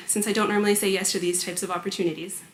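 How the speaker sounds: ordinary delivery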